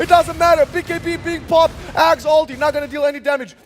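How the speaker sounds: excited esports caster call; play-by-play hype. Real, not acted. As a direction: shout the fight call live.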